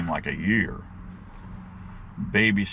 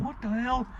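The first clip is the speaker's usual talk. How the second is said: startled yelp; 5 dB quieter than usual. Retold or imitated. Friend startled by gun drawn.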